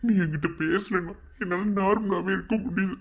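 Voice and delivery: high-pitched crying plea; weepy, desperate confession of love; tearful pleading. Acted. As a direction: beg through tears to be understood. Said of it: "அழுகிறத நிறுத்து".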